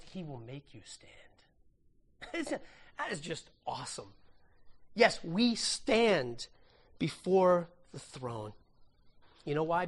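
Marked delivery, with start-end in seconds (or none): 0.0-2.3 slow hushed whisper; whispered assurance. Real, not acted. Intimate assurance to congregation. 5.0-8.5 very slow, deliberate words; slow solemn declaration. Real, not acted.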